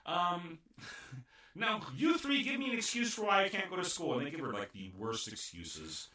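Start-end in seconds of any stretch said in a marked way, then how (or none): 0.1-4.2 put-on high, snippy spoiled-girl voice; bratty princess. Princess scolding her butlers about her fake tooth. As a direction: snap at the butlers like a pampered brat. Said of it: "she's like".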